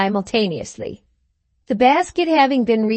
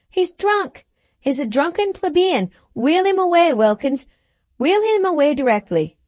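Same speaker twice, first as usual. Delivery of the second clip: high passion; 7 semitones higher than usual. A narrator's reading. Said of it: "said the captain in a high passion".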